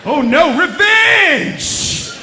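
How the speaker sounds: loud, drawn-out vow of revenge; fired up and ready for revenge; revenge-seeking gangster. Retold or imitated. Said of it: "get out of bed and go".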